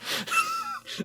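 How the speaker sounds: very high squeaky laugh; squeal of laughter. Real, not acted. Laughing at the joke.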